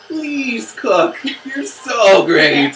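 pleading. Retold or imitated. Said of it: "I'm like".

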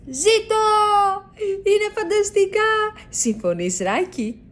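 high, joyful cheer; a mouse thrilled to be flying. A narrator's reading. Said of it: "φωνάζει ο Λάκη".

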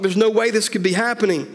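high-pitched, stunned protest; shocked disbelief. A narrator's reading. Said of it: "his dad asked in shock".

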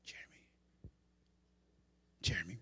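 soft, low whisper of a name; whispered call. Acted. God calling his child without shouting, whispered. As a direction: call gently under the breath, leaving space. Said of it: "not always trying to shout at you".

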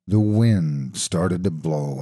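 plain reading voice

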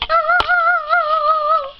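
high loud oh; surprised oh. Real, not acted. Child reacting to the toy contest. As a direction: gasp out a big oh.

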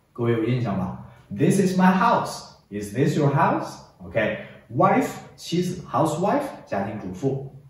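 usual speaking voice

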